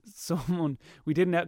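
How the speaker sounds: ordinary talk, nothing marked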